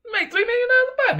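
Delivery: high falsetto jab at him; mock retort. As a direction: needle him in a squeaky put-on voice.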